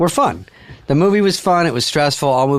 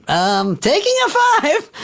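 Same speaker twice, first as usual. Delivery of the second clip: high drawn-out director; slower than usual. Retold or imitated. Director calling a break.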